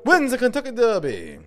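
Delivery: very high, loud, drawn-out derby call; race announcer. Pretend. Comic calls a pretend Kentucky Derby win, race announcer. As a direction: stretch the horse's name to the finish.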